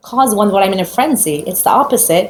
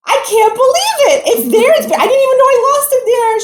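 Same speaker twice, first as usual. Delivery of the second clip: shocked; 13 semitones higher than usual. Retold or imitated. Stunned reaction to the news.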